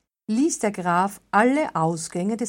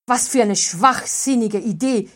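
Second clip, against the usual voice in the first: loud, higher, panicky scorn; called out; 4 semitones higher, 5 dB louder than usual. A narrator's reading. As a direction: blurt it out in fear. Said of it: "rief Wolfgang sogleich". (rief Wolfgang ängstlich)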